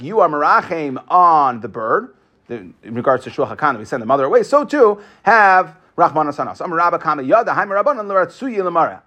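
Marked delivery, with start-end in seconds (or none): none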